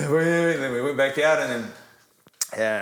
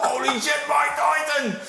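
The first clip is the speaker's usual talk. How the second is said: high-pitched starstruck cry; giddy, high disbelief; awestruck mate; slower than usual. Retold or imitated. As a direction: imitate a friend losing it over a famous caller. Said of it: "My mate's going".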